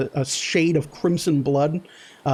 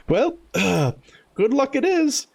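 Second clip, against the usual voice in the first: gentle, higher mock-tender coo; coaxing Lamont; 6 semitones higher than usual. Lamont tucking the rat away, gently cooing. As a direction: soothe the little buddy. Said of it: "He goes".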